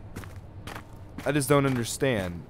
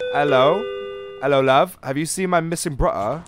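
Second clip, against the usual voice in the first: louder, hammed-up English accent; bad English accent; 4 semitones higher, 6 dB louder than usual. Gamer mock-greeting a shopkeeper about a missing brother, bad English accent. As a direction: put on a poor English accent, pitched up. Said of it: "that was a bad English in person".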